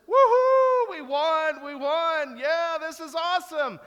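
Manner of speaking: high, loud celebration whoop; victory cheer. Retold or imitated. Fans celebrating the Browns' win, whooping loudly. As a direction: celebrate the win with pure elation. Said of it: "And so there was this".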